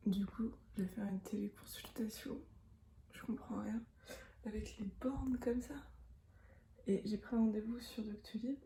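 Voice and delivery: soft whisper in public. Real, not acted. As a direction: whisper so nobody hears. Whispers in the pharmacy.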